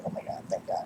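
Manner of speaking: breathless whispered relief; relieved whisper. Retold or imitated. Teller recalls her relief after the treatment, whispered. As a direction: whisper it like the pain just left.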